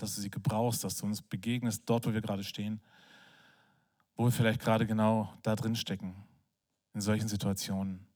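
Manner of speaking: low, soft prayer voice. Real, not acted. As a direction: pray quietly and earnestly, pitch dropped low. Closing prayer to God.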